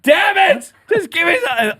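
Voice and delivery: loud, high, exasperated outburst; frustrated shout. Retold or imitated. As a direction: snap it out in pain. In pain, grabbing form.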